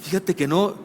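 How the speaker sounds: slow, soft, unbothered no; calm denial. Retold or imitated. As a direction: brush it off calmly, slowly. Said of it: "Y le dije". (tranquilo y despreocupado, lento y suave)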